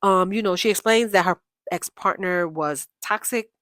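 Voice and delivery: ordinary talk, nothing marked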